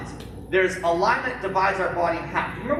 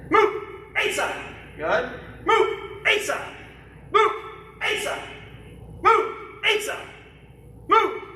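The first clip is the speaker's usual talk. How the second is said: drill command shout; 10 semitones higher, slower than usual. Real, not acted. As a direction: snap the command out to the class. Instructor calling drill commands.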